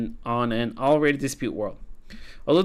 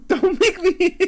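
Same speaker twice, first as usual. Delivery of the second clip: much higher, loud giggly disbelief; amused high girl voice; 5 dB louder, slower than usual. Acted. March laughing at being called a ghost, very high. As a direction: squeal in tickled disbelief.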